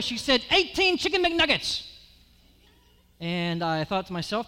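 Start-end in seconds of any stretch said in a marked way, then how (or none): 0.5-1.9 high, mock-yelled order from the counter; server yelling order. Server calling the order back to the kitchen, high mock yell. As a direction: call it out like a busy cashier. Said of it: "yelled back her order".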